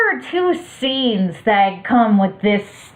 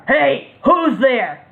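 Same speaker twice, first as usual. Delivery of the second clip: slow gruff thug; 4 semitones higher, slower than usual. Acted. Thug leader voiced.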